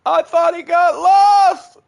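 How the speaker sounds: slow, high, loud shout. Real, not acted. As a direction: shout it out in alarm. Repeated shouted.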